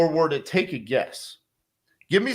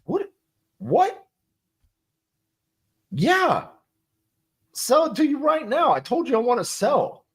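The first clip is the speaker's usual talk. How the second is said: high-pitched, baffled seller who already said yes; 9 semitones higher than usual. Retold or imitated. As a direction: play the confused seller, pitched way up. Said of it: "I would just be like".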